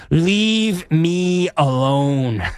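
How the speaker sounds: very slow, stretched-out words; drawn-out mock dismissal. Host hamming up colleague's get-away message, slow and drawn-out. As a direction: draw every word right out, hamming it up.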